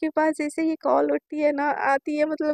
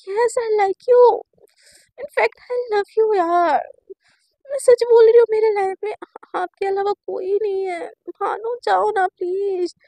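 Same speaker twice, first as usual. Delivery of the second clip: high, slow, breaking into crying; tearful confession; 4 semitones higher, 4 dB louder, slower than usual. Retold or imitated. Girl swearing she loves her crush, tearful and pleading. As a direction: confess it through tears.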